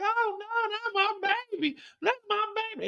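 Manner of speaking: high, soft falsetto mother's lament; mother's wail. Acted. Mother grieving her shot child, high soft wail. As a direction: wail softly for the baby in a high voice. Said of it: "The mama's like".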